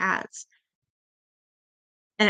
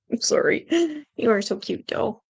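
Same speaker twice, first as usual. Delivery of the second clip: ordinary talk, nothing marked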